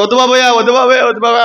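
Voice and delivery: mock a coward begging to be spared; mock panicked pleading. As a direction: vaddu baboy in a hammed-up panicked plea. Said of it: "అన్నాడా?".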